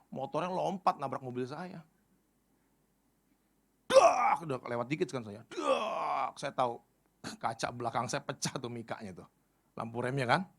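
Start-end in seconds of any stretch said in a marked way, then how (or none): 3.9-4.4 loud, high imitated bang; tiruan bunyi benturan. Meniru bunyi motor menabrak. 5.5-6.4 high imitated bang; tiruan bunyi benturan. Mengulang bunyi tabrakan.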